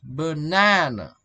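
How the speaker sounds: "banana" slowed, the middle "na" drawn out; stress on the middle syllable. Shown as a demonstration. Teacher showing students the strong middle syllable, "banana" with a long stressed NA. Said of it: "middle one is strong and stressed".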